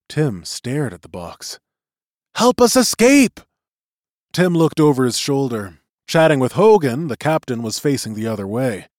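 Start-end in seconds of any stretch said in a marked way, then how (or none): 2.3-3.4 very high, loud plea; shrill voice. A narrator's reading. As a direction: plead loudly in a tiny piping voice. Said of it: "he heard in a shrill voice".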